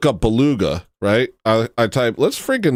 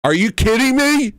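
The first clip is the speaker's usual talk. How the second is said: much higher, outraged disbelief; higher stunned outrage at the chat; incredulous; 13 semitones higher, 3 dB louder than usual. Real, not acted. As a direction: throw it up high in disbelief. Reacting to chat's joke.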